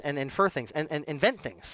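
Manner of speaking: ordinary delivery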